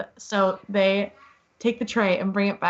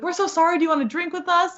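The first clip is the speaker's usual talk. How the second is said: higher-pitched, friendly mock apology; retold gushing apology; effusively apologetic; 8 semitones higher, faster than usual. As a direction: imitate over-nice guys saying sorry. Retold apology from drunk friendly men.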